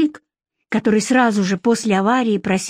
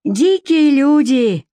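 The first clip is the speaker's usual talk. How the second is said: slow, higher-pitched derision; mocking; 6 semitones higher, 4 dB louder, much slower than usual. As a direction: draw it out in mockery. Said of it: "насмешливо произнёс Егор".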